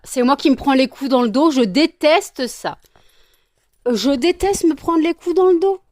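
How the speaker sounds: loud, high, indignant whine; loud mock-indignant moan; loud complaint. Real, not acted. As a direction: protest loudly about being hit from behind. Complaining of hits in her back.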